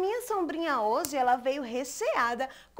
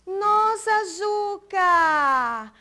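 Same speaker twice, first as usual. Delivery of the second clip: surprised; 5 semitones higher, 5 dB louder, much slower than usual. Acted. Toys found in box.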